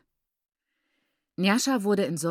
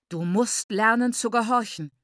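raised, forceful order; stern command; 3 semitones higher, 5 dB louder than usual. A narrator's reading. Father beating his daughter, stern angry command. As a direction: drive the command home.

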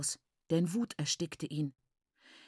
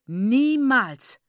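loud, higher, drawn-out shout; repeated furious cry; 4 semitones higher, 8 dB louder than usual. A narrator's reading. Father hitting her other cheek, furious shout. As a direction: hurl the word out in rage. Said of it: "wiederholte er".

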